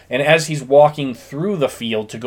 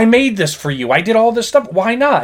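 high, indignant retold complaint; angry protest; 10 semitones higher, 4 dB louder than usual. Retelling Ray protesting to Shoeless Joe, mock angry. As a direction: plead the unfairness, pitched well up. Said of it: "Ray gets angry".

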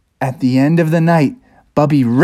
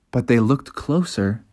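ordinary delivery

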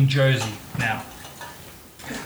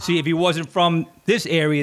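ordinary talk

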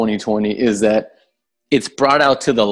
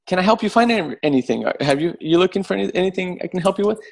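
lightly voiced helpful clerk, pitched up; a higher, politely tentative offer of help; polite store employee; 7 semitones higher, faster than usual. Employee approaching a shopper to offer help, polite and higher.

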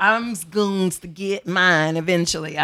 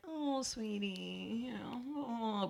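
much softer, slow, airy sweetness; sweet woman voice; 4 semitones higher, 17 dB quieter, much slower than usual. Retold or imitated. Host mimicking women being sweet to each other. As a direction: coo it gently, very soft and drawn out. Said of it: "we're like".

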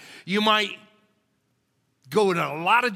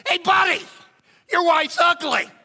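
even higher, loud squawk; parrot yelling; 11 semitones higher, 5 dB louder than usual. Retold or imitated. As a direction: screech the insult like a parrot. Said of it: "the bird yells out".

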